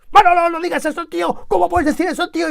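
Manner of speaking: hammed-up outburst at a friend's remark; mock scolding. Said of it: "y unas broncas".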